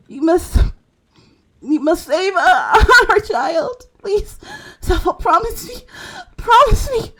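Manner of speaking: high, breaking, tearful begging; desperate plea. Acted. Dying mother begging Zelfar to save their child, high and tearful. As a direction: plead through tears for the child.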